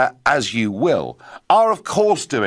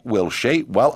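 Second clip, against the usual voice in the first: ordinary delivery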